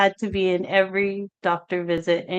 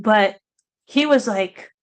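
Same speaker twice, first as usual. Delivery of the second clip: ordinary talk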